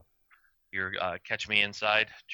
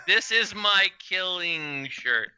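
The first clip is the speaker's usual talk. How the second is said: slow, higher, dragged-out words; reluctant reading aloud; 8 semitones higher, 3 dB louder, much slower than usual. Retold or imitated. Proud mother reads shirt to her child, reluctantly slowing down. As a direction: imitate reading while wishing you could stop. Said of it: "you see her try to stop saying what it says".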